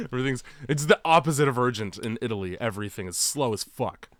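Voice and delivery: ordinary talk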